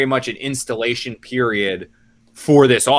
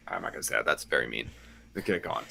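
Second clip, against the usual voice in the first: quiet aside; 8 semitones lower, 14 dB quieter, faster than usual. Real, not acted. Aside after a mean joke.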